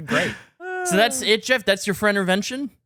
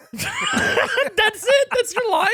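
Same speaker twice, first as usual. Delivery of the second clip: high, laughing disbelief; much slower than usual. Real, not acted. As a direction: laugh through the question, way up high. Laughing disbelief at short line.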